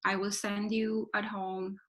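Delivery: normal talking voice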